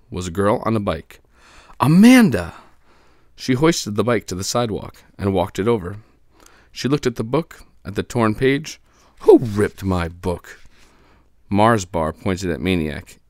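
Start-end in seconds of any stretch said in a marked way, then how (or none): 1.8-2.5 high, loud exclamation of the name; excited announcement. A narrator's reading. Narrator announces her arrival. 9.2-10.6 stern demand. Acted. Amanda confronts the bullies.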